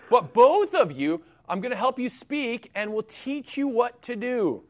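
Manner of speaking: ordinary talk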